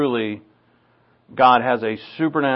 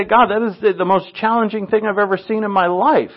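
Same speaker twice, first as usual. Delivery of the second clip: higher, exasperated protest to God; pleading with God over the challenge, higher; mock overwhelmed self; 8 semitones higher than usual. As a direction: voice the overwhelmed believer, pitched up. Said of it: "I say".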